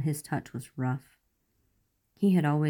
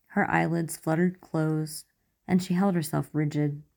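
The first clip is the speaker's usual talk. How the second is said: usual speaking voice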